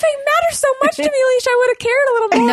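high mocking voice. Mock self-scolding.

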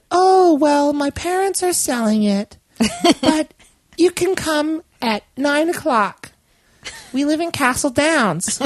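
put-on high girly voice, a touch awkward; teen girl voice. Quoting the teen girl seller in a high girly voice. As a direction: play the hesitant sixteen-year-old seller.

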